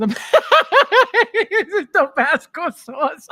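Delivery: real giggling question; laughing. Real, not acted. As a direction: ask it while still laughing. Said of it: "I'm crying".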